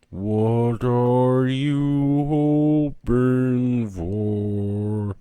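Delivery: slowing record. Acted. Diana's voice slowing like a record player changing speed. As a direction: let the voice wind down like a record player. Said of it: "voice is slowing down as if the record player is changing speed".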